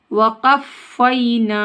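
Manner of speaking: वक़फ़ैना chanted slowly with "fay-ee" glide; correct recitation. Shown as a demonstration. Teacher modelling the verse, slow "fay-ee" in वक़फ़ैना. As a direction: draw out वक़फ़ैना, doubled f, long final vowel. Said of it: "वक़फ़ैना नहीं".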